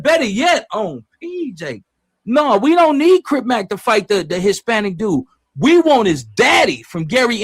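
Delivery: loud, high and fired up, riffing on the chat; going off on a passionate rant for the stream; heated rant. Real, not acted. Heated rant about C-Mac's father.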